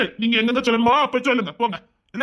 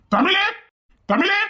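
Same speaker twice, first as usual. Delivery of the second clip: calling out; 10 semitones higher, much slower than usual. Acted. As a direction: call her name from another room. Father calling wife from afar.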